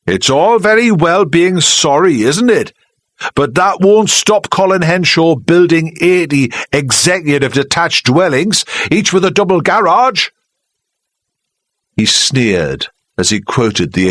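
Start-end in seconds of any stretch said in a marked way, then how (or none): 0.0-10.3 shouting sneer. Acted. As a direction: spit out the planning wording. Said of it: "Charlie shouted".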